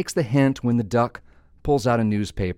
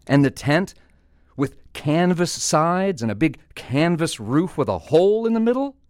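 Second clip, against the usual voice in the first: mounting disbelief; 7 semitones higher than usual. Acted. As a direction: climb to a very high questioning finish. Duck's disbelief building.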